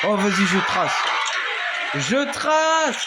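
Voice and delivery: high, loud panicked flight; scared horror game streamer. Real, not acted. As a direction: run for it, yelling. Fleeing monster.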